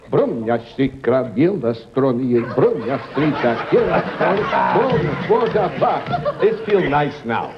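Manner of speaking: mock-Russian accent, telling a story in gibberish; Russian double talk. Shown as a demonstration. Comic performing for the host, Russian double talk. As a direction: fake Russian with key words poking through. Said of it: "I'll do a Russian now".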